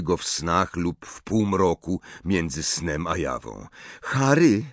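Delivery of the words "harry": high, questioning Hermione; checking in. A narrator's reading. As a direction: call back a friend lost in thought. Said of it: "zapytała Hermiona". (pytająca Hermiona, wysokim głosem)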